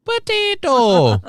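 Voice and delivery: slow, loud Irish potato, high then falling; Irish accent. Acted. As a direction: copy the coach's Irish lilt, drawn out. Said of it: "I can say one word in an Irish accent".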